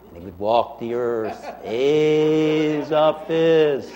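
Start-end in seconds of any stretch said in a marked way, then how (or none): 1.6-3.9 slow drawn-out ghostly call. Acted. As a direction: moan the name like a wandering ghost. Ghost calls out the name.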